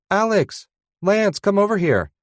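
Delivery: high, loud call across a crowd. Acted. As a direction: shout for them to come over. Calling them across carousel line.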